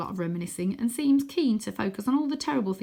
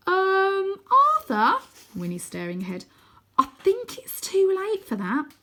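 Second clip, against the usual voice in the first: uneasy; 12 semitones higher, 6 dB louder than usual. Acted. Wyn sees Croaky.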